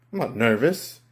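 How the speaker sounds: normal talking voice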